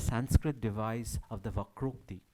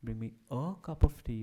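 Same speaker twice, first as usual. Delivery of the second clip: "a" said in full and leaned on; stress on A. Shown as a demonstration. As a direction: put the accent on the little word "a". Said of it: "where you put the accent".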